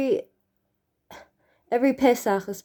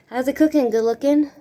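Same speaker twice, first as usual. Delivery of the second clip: higher, louder, bouncy teasing; playful singsong; 5 semitones higher, 4 dB louder than usual. Pretend. Vlogger checking her makeup in a playful singsong. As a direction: tease yourself in the mirror. Playful rhyme again in mirror.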